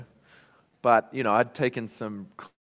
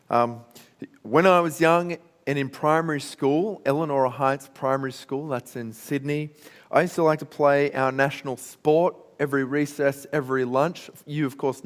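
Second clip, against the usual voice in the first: ordinary talk, nothing marked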